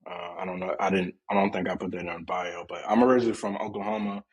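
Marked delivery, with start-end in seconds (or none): none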